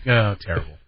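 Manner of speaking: normal talking voice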